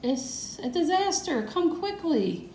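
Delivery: high, urgent, slow pleading call; hammed-up Grandpa begging them to hurry; mock shouting. Grandpa calls family up the stairs, high hammed-up shout. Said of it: "He was still shouting".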